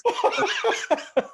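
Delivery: shrieking cringe laugh; high embarrassed laugh. Real, not acted. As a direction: let out a high helpless laugh. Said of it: "most embarrassing thing".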